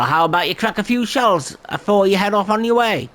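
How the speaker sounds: put-on an airship mechanic moaning to the player about crabs, in a rough accent; rough working-class accent. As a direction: grumble like an annoyed airship mechanic. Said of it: "doing one accent, rough voice".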